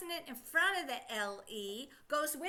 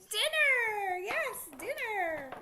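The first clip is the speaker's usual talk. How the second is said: dinner much higher and louder, then a slow yes; delighted reveal of dinner; excited reveal; 8 semitones higher, 6 dB louder, much slower than usual. Shown as a demonstration. Teacher revealing dinner to the class, higher and louder.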